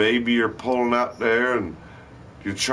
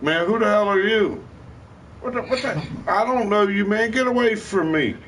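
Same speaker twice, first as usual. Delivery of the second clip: much higher, fake-offended dismissal; mock brush-off; 13 semitones higher than usual. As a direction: act like you've never met him. Playing it off to fiend.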